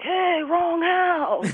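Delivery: high, slow, drawn-out call of a tipsy husband; mock the husband slowly pointing out the mistake; hammed-up drunk neighbor, high and slow. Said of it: "her husband's like".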